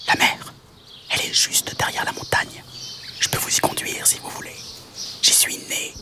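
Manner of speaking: breathy whisper, no voice; whispered tiny insect voice. Acted. Mantis asking the animals where they go, whispered. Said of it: "proposa la mante".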